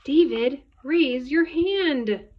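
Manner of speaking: loud, high, drawn-out command; scolding teacher. A narrator's reading. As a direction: stretch the order out like an exasperated teacher. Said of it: "David's teacher always said".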